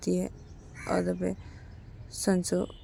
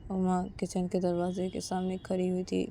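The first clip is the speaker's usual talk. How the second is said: ordinary delivery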